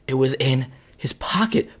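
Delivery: ordinary delivery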